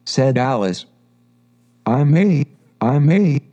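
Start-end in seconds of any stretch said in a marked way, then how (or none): 1.9-3.4 hesitant, stumbling restart, a little higher; unsure of herself, trips over the words; doubtful. Acted. Alice telling the pigeon what she is, doubtfully. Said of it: "she added rather doubtfully".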